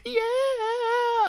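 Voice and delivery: long falsetto metal shriek; high-pitched wail. Retold or imitated. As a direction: imitate a rocker's drawn-out high note. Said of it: "I heard a high pitch".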